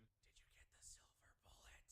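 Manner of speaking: hushed, whispered question; put-on whisper. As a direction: whisper it like a secret. Joking aside in the retold collapse.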